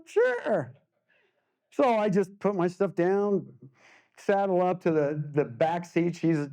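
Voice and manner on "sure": high squeaky sure. Retold or imitated. Painter caught off guard by a mom, answers in a high squeak. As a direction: pitch way up for a meek little sure. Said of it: "I'm going".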